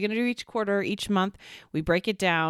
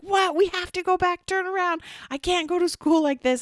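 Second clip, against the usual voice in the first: put-on much higher, frantic kid voice; panicked kids; 12 semitones higher than usual. Daughters panicking in the car, high frantic pleading. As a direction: play the girls begging mom to turn around. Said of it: "started freaking out".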